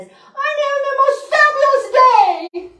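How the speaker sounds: high, loud, sing-out mock elation; emphatic joyful decree. Speaker imitates a decree nobody gives, gleefully emphatic. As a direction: belt it out, absurdly thrilled. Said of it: "emphatic decree that says".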